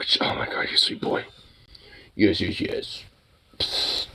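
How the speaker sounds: whispering. Real, not acted. Whispering to the dog.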